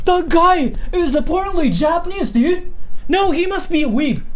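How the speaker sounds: put-on high, sneering gamer kid; a hint of a scornful lobby kid; mocking gamer voice. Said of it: "People just go like".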